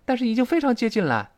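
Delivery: high exclaimed translation; dramatised concern; acted alarm. Acts out translated worried reply.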